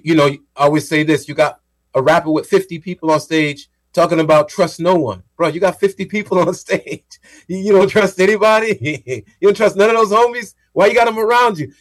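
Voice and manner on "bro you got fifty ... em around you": high-pitched, laughing disbelief; mock incredulous challenge.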